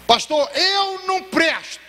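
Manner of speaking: high, very slow, broken confession; drunk man's despair. Retold or imitated. A drunk Marcelo confesses his worthlessness to the pastor outside, in despair. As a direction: drag out the shame word by word. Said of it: "Marcelo olhou pra mim e disse".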